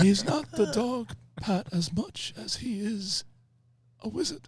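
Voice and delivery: high, slow, frail dying voice; dying old wizard. Acted. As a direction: fade out like a dying old man.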